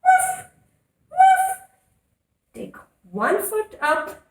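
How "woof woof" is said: high, loud playful barking; cheerful mock dog bark; happy dog woof. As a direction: bark like an excited puppy for the kids. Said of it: "let's woof like happy dogs".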